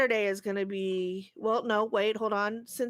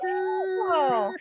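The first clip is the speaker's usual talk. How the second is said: cowboy yeehaw; 8 semitones higher than usual. Pretend. Cowboy whoop.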